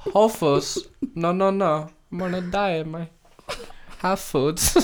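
put-on comic Spanish-accented voice. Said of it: "keep going on with Spanish bits".

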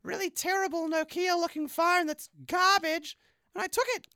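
put-on high, bright girl voice; girl character voice. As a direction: pitch way up as the phone thief girl.

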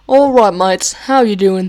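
loud, hammed-up British greeting; fake British accent. Podcaster signing off to listeners, loud fake British accent. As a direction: call out like a mock British lad.

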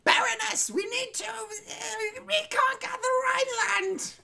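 very high, slow cartoon villain screech; Cobra Commander voice. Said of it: "I'm just doing Cobra Commander".

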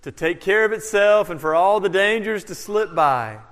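usual speaking voice

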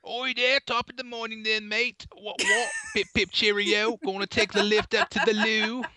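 high-pitched, hammed-up British accent. Trying to compete in the British off, high mock British. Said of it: "let's have a British off right now".

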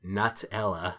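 Nutella said as NUT-ella; first vowel of Nutella as in "nut"; nut pronunciation. Shown as a demonstration. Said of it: "I always pronounced it".

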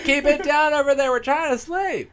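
high-pitched grumpy neighbor voice; cranky neighbor. Pretend. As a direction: shush them from far off. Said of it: "a mile away, they're like".

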